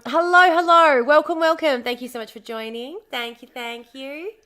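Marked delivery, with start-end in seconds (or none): 0.1-1.8 louder, higher welcome; cheery welcome. Real, not acted. Streamer welcoming new viewers, cheery. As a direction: call out a bright welcome.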